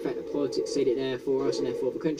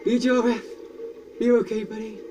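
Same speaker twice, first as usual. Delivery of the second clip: high concerned call; worried; 8 semitones higher than usual. Real, not acted. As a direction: check on a buddy. Checking on hurt friend.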